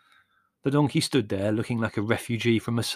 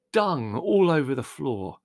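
slow, raised, appalled disgust; 4 semitones higher, 4 dB louder, slower than usual. A narrator's reading. Larry complaining about the donkey's mess, disgusted. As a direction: dwell on the filth with revulsion. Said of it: "said Larry in disgust".

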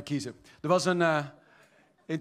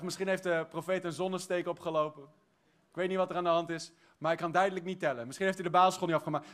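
rushed doubting thought; faster than usual. Retold or imitated. Servant doubts the prophet.

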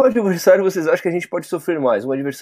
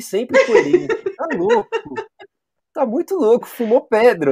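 laughing while talking; 10 semitones higher, slower than usual. Real, not acted. Laughing at friend's take.

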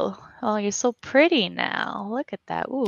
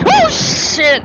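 high shriek of fright; startled scream; 14 dB louder than usual. Real, not acted. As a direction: yelp in sudden terror. Jump scare in horror game.

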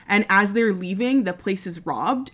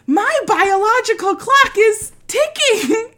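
Marisa Tomei as Mona Lisa; 12 semitones higher, 5 dB louder, slower than usual. Retold or imitated. Girlfriend pouting at Vinny in the cottage, high slow New York drawl. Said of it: "looking at him going".

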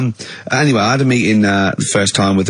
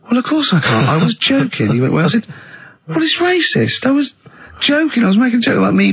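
much higher, squeaky, defensive retort; indignant protest; 13 semitones higher than usual. Retold or imitated. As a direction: protest in a high flustered voice. Retold indignant reply to bloke.

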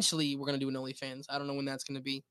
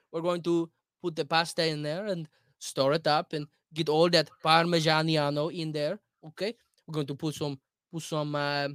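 very Italian American accent. Shown as a demonstration. Food show host introducing a recipe, mock Italian accent. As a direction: play up the Italian accent on every word. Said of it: "you're an Italian American, very Italian American".

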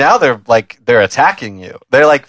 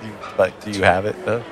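hushed, lower secret question; furtive undertone; 5 semitones lower, 9 dB quieter, faster than usual. Acted. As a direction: ask under your breath. Covertly asking waiter for crack.

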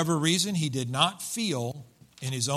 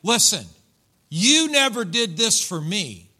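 raised, loud, drawn-out accusation; resentful older brother; 6 semitones higher, 7 dB louder than usual. Acted. Older brother confronts his father over the welcome, aggrieved. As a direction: confront your father, stretching the words. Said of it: "that's the way he said it".

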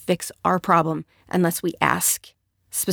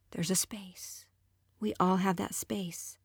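soft breathy whisper; 12 dB quieter, slower than usual. Real, not acted. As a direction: lean in and whisper it. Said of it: "whispered point".

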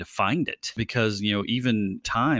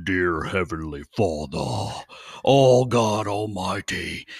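slow, drawn-out preacher prayer voice, building louder; 4 dB louder, much slower than usual. Retold or imitated. Imitating a missionary's prayer voice to the church, slow and swelling. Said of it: "then he'd get his preacher voice".

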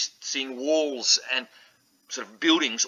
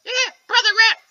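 very high, loud little rat voice; squeaky rat voice; 8 dB louder than usual. Acted. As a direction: speak like a tiny proud rat.

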